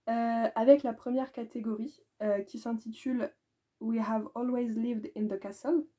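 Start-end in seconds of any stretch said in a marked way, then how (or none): 3.8-5.8 put-on English title read with a heavy French accent. Booktuber reading an English book title, in a thick French accent. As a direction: stumble through the English words the French way. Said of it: "Quand je parlais d'accent".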